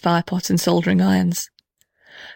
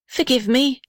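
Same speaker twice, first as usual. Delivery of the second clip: higher, pleading forgive me; pleading cry; 6 semitones higher than usual. A narrator's reading. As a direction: beg forgiveness in a raised voice. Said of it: "cried the little red house".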